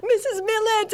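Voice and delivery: very high mock wail of fright; scared for his life. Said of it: "all scared for his life".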